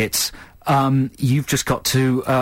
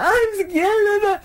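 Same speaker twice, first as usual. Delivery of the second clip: high, cooing yeahs; mock consoling parent; slower than usual. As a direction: coo along like a soothing parent.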